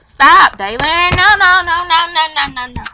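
loud sudden stop, then rapid no-no-no; loud scolding that eases off by the end; urgent scolding. Real, not acted. Stopping little brother's misbehaviour.

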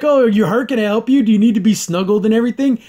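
hammed-up clingy friend; doting mock voice. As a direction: smother her with mock concern. Imitating Scorpia fussing over Catra.

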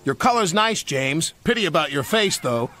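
role. Acted. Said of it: "said a freight car".

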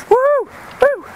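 two high woos; high-pitched whooping. Real, not acted. Vlogger bitten by an ant, high whooping.

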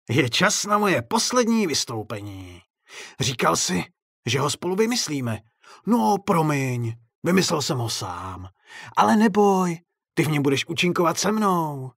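high, theatrical clown voice; gloating clown showman. A narrator's reading. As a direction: announce a final act with menacing glee. Clown announces his last show.

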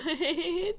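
high, soft mock giggle; imitated girl's giggle. Player imitating the girl who was laughing, mock giggle. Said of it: "bir tane kız gülüyordu".

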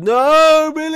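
very high, loud, stretched-out wail; hammed-up scream after a lost child; mock anguished cry. Gamer crying out for a child in the game, mock anguish.

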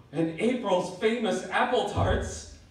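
slow, loud and laughing, stretching apple; laughing delight. Acted. Friend delighted by the baker's apple tarts, laughing. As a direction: savour it with a laugh.

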